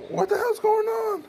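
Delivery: high-pitched figure voice. Acted. Figure bursts into scene.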